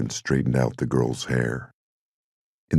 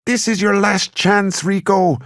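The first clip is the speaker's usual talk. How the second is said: playful gangster shout; 6 dB louder than usual. Acted. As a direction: bellow it like a movie gangster at a standoff. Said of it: "He positioned his hands ... and shouted".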